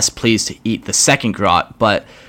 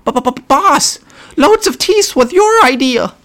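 much higher squeaky goblin voice; a nervous goblin pleading with the boss; grot voice; 5 dB louder, slower than usual. Acted. Grot stammering voice.

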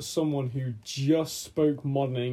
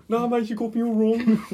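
higher, louder laughing mock Brummie plea; Birmingham accent; 7 semitones higher, 5 dB louder than usual. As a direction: beg the mob in a Birmingham accent. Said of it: "Birmingham accent".

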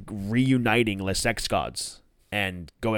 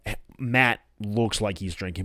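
ordinary talk

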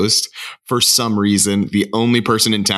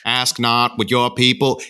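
a hint of a Kennedy Boston accent, higher; JFK Boston accent; 4 semitones higher than usual. Friend imitating the mayor's JFK accent. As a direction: lightly imitate JFK's Boston speech. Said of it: "it's this kind of voice".

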